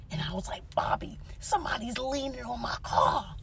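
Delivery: hushed, breathless whisper; frightened whisper into the phone; scared whisper. Retold or imitated. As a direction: whisper so he won't hear you. Whispering to friend, man at car.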